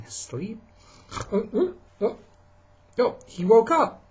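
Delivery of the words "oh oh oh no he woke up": high surprised squeaky cries; startled waking squeak. Acted. Parent reading to kids, bear wakes with a high startled squeak. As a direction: yelp awake, high and startled.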